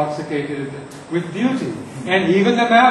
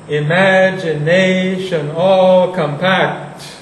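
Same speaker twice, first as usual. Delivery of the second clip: slow, each syllable weighted, "all" leaned on; slow, even stresses; 6 dB louder, much slower than usual. Shown as a demonstration. Teacher showing students one way to read the line, slow. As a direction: drag out "Imagination all compact" evenly. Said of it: "You can read it like that".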